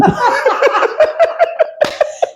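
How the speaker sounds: breathy high laugh burst; high giggle. Real, not acted. Laughs after deep voice try.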